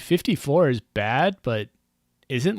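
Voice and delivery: usual speaking voice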